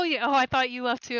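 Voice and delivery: ordinary talk, nothing marked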